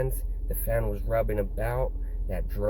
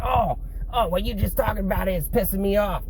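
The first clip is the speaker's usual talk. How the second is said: imitating a man getting angry, much higher; mock angry outburst in a raised pitch; mad; 9 semitones higher than usual. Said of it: "he gets all mad".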